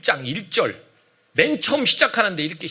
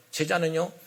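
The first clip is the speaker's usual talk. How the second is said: normal talking voice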